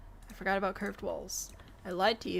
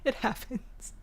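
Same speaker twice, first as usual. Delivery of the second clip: slower, words loosened by laughter; amused, laughing; 2 semitones higher, 4 dB quieter, slower than usual. Real, not acted. Streamer excusing being caught live, amused and laughing. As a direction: shrug it off while recovering from laughing. Still laughing at own accent slips.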